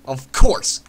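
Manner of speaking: loud bitter "Of course!" at the empty crate; sarcastic. Real, not acted. Gamer finds empty crate, loud sarcastic outburst. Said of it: "That would be ridiculous".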